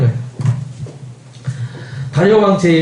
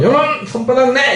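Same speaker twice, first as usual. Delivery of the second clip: higher-pitched mock command; stern teacher ordering punishment; 6 semitones higher than usual. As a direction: play a teacher demanding the palm. Acting a teacher punishing a pupil.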